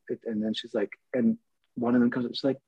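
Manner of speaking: ordinary talk, nothing marked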